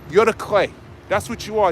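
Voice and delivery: usual speaking voice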